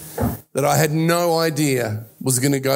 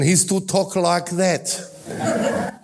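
lightly put-on Italian accent, slowed right down; much slower than usual. Preacher imitating his dad for the congregation, Italian accent. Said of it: "my dad's Italian".

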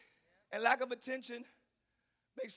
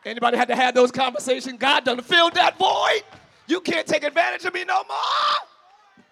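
excited screaming; 5 semitones higher, 16 dB louder than usual. Real, not acted. Preacher screaming to the congregation, excited shout. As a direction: let it out like a revival high point. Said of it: "I'm sorry, I'm screaming too much".

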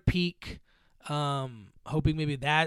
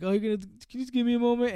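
hurried request; 8 semitones higher, much faster than usual. Retold or imitated. Harrison Ford asks for a moment.

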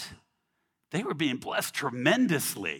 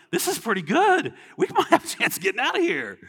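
hopeful excitement; 12 semitones higher, 5 dB louder than usual. Retold or imitated. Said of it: "Others are like".

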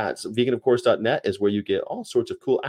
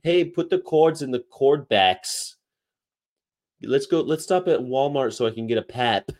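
higher, imitated Chicago accent; 4 semitones higher than usual. Quoting former tour companion from Michigan, imitated Chicago accent. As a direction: exaggerate the flat Chicago vowels. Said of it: "and she would say".

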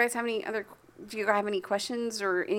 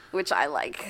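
ordinary talk, nothing marked

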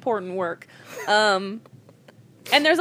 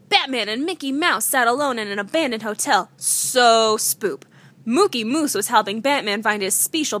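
higher, mock-dramatic read-aloud; story reading; 5 semitones higher, 4 dB louder than usual. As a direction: read the silly tale like a spooky bedtime story. Reads silly story aloud.

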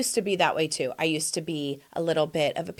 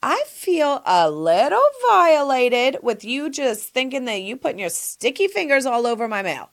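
role-play confrontation; 4 semitones higher, 5 dB louder than usual. Acted. Host tells a nosy friend how she feels, affronted. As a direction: act mildly affronted, stretching the words. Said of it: "That's what I would say".

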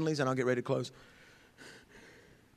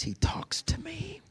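slow whisper, "talks" floating up high; hushed and slow; 4 dB quieter, slower than usual. Real, not acted. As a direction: confide it in an awed whisper. Sincere testimony.

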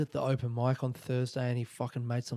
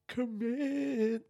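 "Commit" sung out high and drawn; emphatic; 11 semitones higher than usual. Shown as a demonstration. Repeating the lesson learnt, "Commit" said high and long. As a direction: lift "Commit" way up, stretching it.

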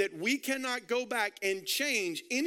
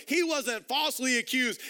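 ordinary talk

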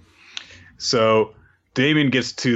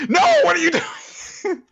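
much higher, laughing cry of alarm; high alarmed shriek. Retold or imitated. Spy catching Lloyd, high comic alarm. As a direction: yelp it in comic panic.

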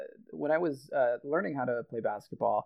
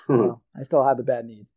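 normal talking voice

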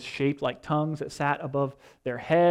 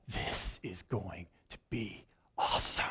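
awed whisper; 9 semitones lower, 12 dB quieter than usual. Retold or imitated. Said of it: "and you're like".

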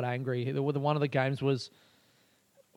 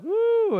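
very high, loud "woo"; imitated crowd whoop; 6 dB louder than usual. Audience welcoming five minutes of stand-up, whooping.

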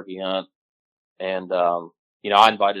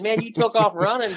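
quoting Caleb a lot higher, half amused; buddy's raised voice; 11 semitones higher than usual. Retold or imitated. Retelling a hunting buddy's words to friends, raised pitch. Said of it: "He said".

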